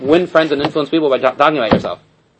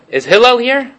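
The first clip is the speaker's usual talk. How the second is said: higher-pitched offhand summons; imitating a cheeky caller at the door; disrespectfully casual; 8 semitones higher, 3 dB louder than usual. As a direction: call for him with no deference. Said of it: "Like he's just like".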